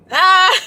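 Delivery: high, loud overwhelmed squeal; emotional squeal. Real, not acted. Vlogger overcome by joy after the workshop, squealing. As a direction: let a happy cry burst out. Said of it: "I'm getting emotional".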